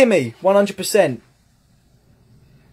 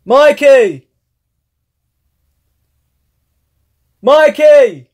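calling out; 6 semitones higher, 7 dB louder, slower than usual. Real, not acted. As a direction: call loud across the house, higher. Calling brother about the pizza.

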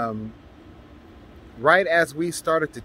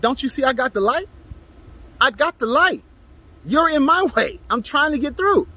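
yelling back; 10 semitones higher than usual. Retold or imitated. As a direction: yell it back, insisting you have the green light. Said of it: "I yelled back and said".

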